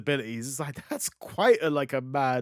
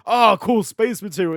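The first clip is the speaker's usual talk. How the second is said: naive delight; 6 semitones higher, 10 dB louder than usual. Retold or imitated. Oblivious hero voiced in retelling.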